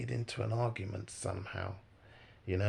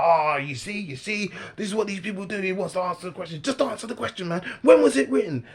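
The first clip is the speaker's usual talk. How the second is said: high-pitched heated rant, peaking loud; mock a worked-up heckler demanding answers; imitating angry shouting; 7 semitones higher, 7 dB louder, faster than usual. Heckler accuses him of dodging the question, shouting angrily. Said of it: "Why are you shouting for".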